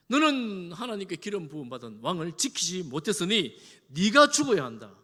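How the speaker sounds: loud, forceful scolding; thundering rebuke. Acted. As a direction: roar the accusation at a negligent guard. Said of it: "다윗이 호통을 칩니다".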